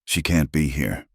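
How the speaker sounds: plain reading voice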